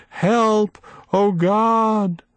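high, desperate wail for help; a trapped old woman crying out to God. A narrator's reading. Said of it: "she wailed".

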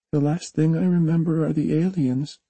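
put-on high child voice, uneasy; young girl remembering something scary; frightened little girl. A narrator's reading. Jamie recalls painful memory.

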